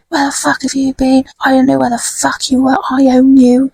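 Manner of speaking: mock the weaselly man snapping at the kids; nasal rat weasel. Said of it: "you heard a nasally noise".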